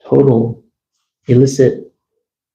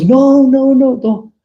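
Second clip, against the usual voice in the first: mock girls' plea; 13 semitones higher, slower than usual. Girls pleading, curtains closing.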